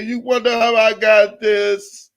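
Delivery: high, gloating gossip-host voice; Wendy Williams. Acted. Blogger taunting Tasha K about a scoop, in a Wendy Williams voice. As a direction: tease it up high like a talk-show host with a scoop. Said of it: "In my Wendy Williams voice".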